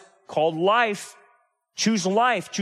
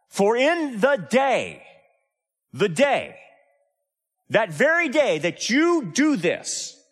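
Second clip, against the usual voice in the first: emphatic, slow scripture warning, higher at the start; God's warning, quoted; 5 semitones higher, slower than usual. Acted. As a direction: voice God's warning slowly, with weight. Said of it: "God's saying".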